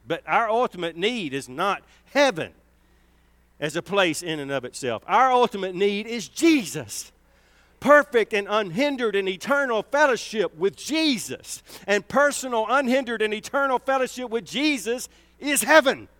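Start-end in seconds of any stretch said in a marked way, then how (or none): 5.1-16.1 high, loud preacher's climax; preaching at full force. Acted. Preacher declaring to the church that Jesus is heaven, high and loud. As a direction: lift the church with each phrase.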